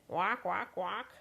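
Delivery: honked goose call "walk, walk, walk". Acted. Parent reading, the goose honks at the baker.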